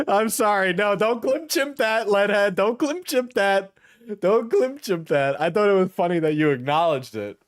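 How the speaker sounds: high, squeaky voice through laughter; pleading while cracking up; helpless laughter. Real, not acted. Streamer begging chat not to clip that, laughing helplessly. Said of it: "That's why I'm laughing".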